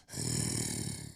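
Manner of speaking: very low, long throaty drone; comic deep hum before speaking; imitated low rumble.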